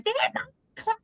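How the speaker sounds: high-pitched imitation of a complaining kid; protesting child. Mum retelling her nine-year-old's protest, high mock child voice. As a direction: squeal it like an objecting little girl.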